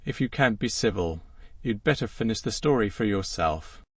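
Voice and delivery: ordinary delivery, nothing marked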